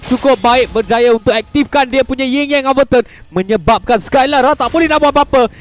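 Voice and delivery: high, loud hyped kill call; excited shouting. Real, not acted. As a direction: shout the takedown like a live caster. Kill call after Skylar falls.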